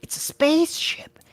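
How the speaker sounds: high, stunned realization; stunned awe. A narrator's reading. Chester to himself, stunned by the spaceship. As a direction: gasp it out like seeing aliens.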